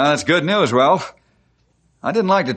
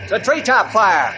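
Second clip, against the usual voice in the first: high, raised voice over the din; urgent command; 9 semitones higher than usual. Acted. Ranger directs crew at treetop fire, urgent.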